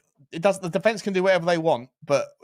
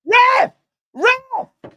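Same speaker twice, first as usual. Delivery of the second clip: shrill, loud cry for the ref; shouting at the ref; 9 dB louder, much slower than usual. Real, not acted. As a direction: scream for the referee. Shouting at ref during match.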